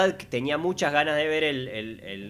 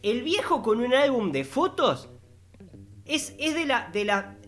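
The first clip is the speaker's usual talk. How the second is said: raised, exasperated mocking tone; mock the old man, pitched up; high mocking voice; 9 semitones higher than usual. Mocking old man with photo album.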